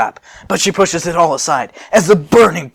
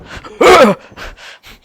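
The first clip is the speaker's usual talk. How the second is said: loud high villain laugh; evil cackle; 10 dB louder than usual. Acted. Broly laughing as he attacks, loud evil cackle. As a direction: cackle as the brute.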